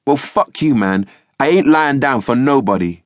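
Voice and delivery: shouting match. A narrator's reading. Youth outshouting the police sergeant, angry yelling. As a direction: yell him down, outshout him. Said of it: "compete in the shouting match".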